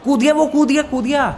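high, excited cry; frantic alert. Acted. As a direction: call out the jump in high-pitched alarm. The ghost jumps into the portal.

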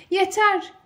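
high, loud cry of enough; shout. A narrator's reading. Alice, covering her ears, shouts at Louis. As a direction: cut him off sharply. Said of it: "diye bağırdı kulaklarını tıkayan Alice".

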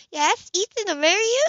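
high, loud thrilled question; delighted surprise. Acted. Anna thrilled that Grace proposed to Ethan, high and loud. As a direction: gasp it out in delight.

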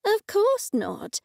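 high, drawn-out amused dismissal; chuckled reply. A narrator's reading. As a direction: scoff lightly in a high, slow voice. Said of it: "I chuckled".

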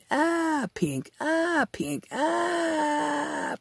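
high, wailing cry on each ah, dropping on pink; mock falling scream. Telling his late dad about the fall, in a high mock wail. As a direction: ham up a body hitting rocks on the way down. Acting out a falling head impact.

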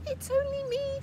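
timid little goat; squeaky little goat. Acted. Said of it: "said the little billy goat Gruff".

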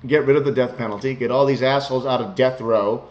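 ordinary talk, nothing marked

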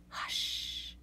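soft whispered hush. A narrator's reading. Grandma hushing Llama at bedtime, whispered. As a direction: whisper like settling a child.

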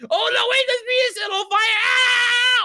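high, alarmed shouting into a scream; panicked scream. Acted. Player whose treehouse is burning, screaming in alarm. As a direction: cry out in panic, ending on a loud shriek. Treehouse catches fire around him.

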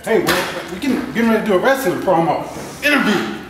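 high, loud hype wrestling announcer; wrestling promo voice. Acted. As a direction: ham it up like a ring announcer. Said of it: "you're supposed to promote my signing, not promote a match".

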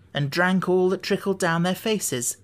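usual speaking voice

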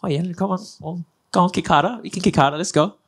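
high-pitched mock goading of an opponent; playful taunting. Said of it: "more of those sort of notions".

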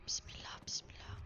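whispered nervous prayer; whispering while hiding. Real, not acted. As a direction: breathe a scared prayer.

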